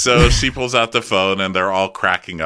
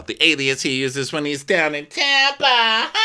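mock gleeful gossip; 7 semitones higher than usual. Mimicking a housewife loving the gossip.

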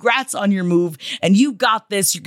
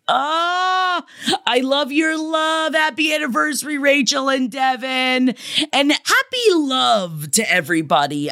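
high, gushing happy shout; excited; 6 semitones higher than usual. Real, not acted. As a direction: squeal it out with delight. Gushing reply to the anniversary letter.

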